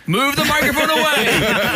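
high, slow, teasing call; imagine Bruce's handlers shouting; mock producer. Joking about Bruce's handlers, high teasing call. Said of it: "people in his ear going".

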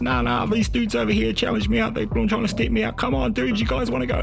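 high-pitched, worked-up confrontation; agitated challenge. Retold or imitated. Student challenging dudes in the lecture, agitated and defiant. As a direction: ride high in pitch, spoiling for a fight. Acted quarrel, challenging guys behind.

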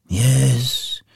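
understated regretful agreement; sighing. A narrator's reading. Scrooge agrees with the spirit, sighing. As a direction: let the words out as a sigh. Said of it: "sighed Scrooge".

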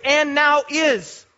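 "and now is" very slow, word by word; emphasised phrase. Shown as a demonstration. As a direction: weigh each word of "and now is". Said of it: "then this three-word phrase".

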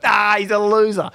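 high, teasing put-down; mocking mate. Mimicking his mate mocking the injured friend, high and teasing. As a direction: tease him like a cheeky mate. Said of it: "He's like".

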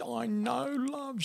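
very high, overcome phone voice; squeaky-high emotional agreement; imitated laughing and crying. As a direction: act out joyful near-tears. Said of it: "And she's laughing and crying".

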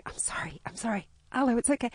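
hushed, breathless sorry; whispered apology. Retold or imitated. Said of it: "I just kept going".

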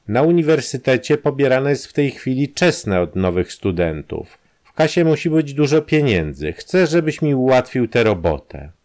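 lightly voiced blackmailer, pushing; Kramer's voice. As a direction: press the demand home.

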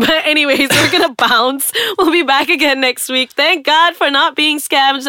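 high, loud laughing goodbye; laughing sign-off. Real, not acted. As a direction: wrap up through laughter.

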